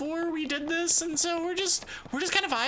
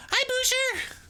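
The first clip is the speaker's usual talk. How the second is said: cute anime streamer; 11 semitones higher, 3 dB louder than usual. Acted. Greeting chat, high far voice.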